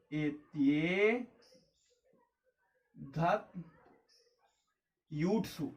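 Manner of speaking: एते, धत, यूट्सु spoken slowly and softly, one word at a time; very slow dictation. Shown as a demonstration. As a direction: spell out the rule word by word. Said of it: "ये सूत्र है हमारा". (धीरे-धीरे सूत्र)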